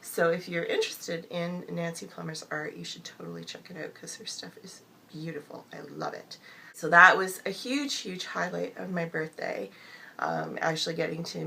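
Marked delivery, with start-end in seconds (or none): 2.8-6.4 whisper. Real, not acted. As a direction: voice fading to a hoarse whisper. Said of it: "excuse the gravelly sound and the whispering".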